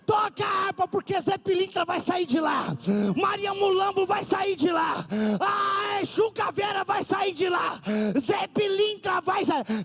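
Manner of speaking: high, loud shouted sermon; fiery preaching. Acted. Preacher shouting to the congregation, fiery high preaching. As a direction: preach it full force from the pulpit.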